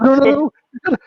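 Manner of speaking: very high, urgent retold protest; mock hasty reassurance. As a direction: imitate someone frantically talking a man round. Acts Joe hastily reassuring dealer.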